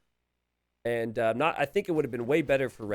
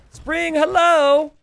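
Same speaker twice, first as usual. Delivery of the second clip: high, loud, slow drawn-out yell; playful stretched-out shout; calling out; 12 dB louder, much slower than usual. Pretend. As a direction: holler for a distracted player. Calling out to inattentive player Spring.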